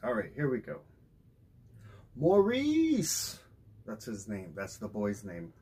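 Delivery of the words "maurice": high calling cry for his son. A narrator's reading. Father calling his son from the woodpile, high and loud. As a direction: call the boy's name across the yard. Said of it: "cried Daddy".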